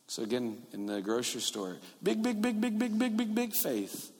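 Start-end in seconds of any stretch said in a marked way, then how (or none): 2.0-4.1 high, soft, bouncing chant; sing-song repetition. Acted. Preacher recalling the grocery store story, high sing-song. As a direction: repeat it in a high, even little patter.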